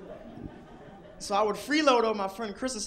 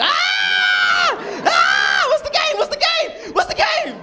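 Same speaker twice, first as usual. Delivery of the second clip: very high, loud excited shrieks; excited kid shouting; 12 dB louder, much slower than usual. Retold or imitated. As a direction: beg to know the game. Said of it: "I'm like".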